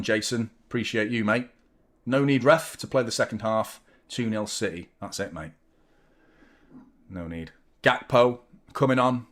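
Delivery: usual speaking voice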